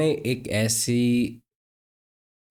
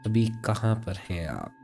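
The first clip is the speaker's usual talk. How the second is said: guided meditation; 3 semitones higher than usual. Acted. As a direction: guide them unhurriedly through breathing, drawing words out.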